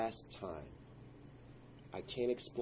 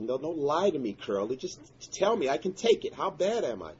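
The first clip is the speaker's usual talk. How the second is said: high, loud pleading; desperate plea; 6 semitones higher, 7 dB louder than usual. Retold or imitated. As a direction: beg him to tell the truth, voice raised. Begging neighbor for the truth.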